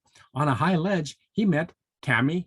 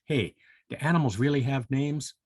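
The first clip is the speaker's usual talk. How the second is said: usual speaking voice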